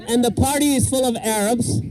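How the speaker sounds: ordinary delivery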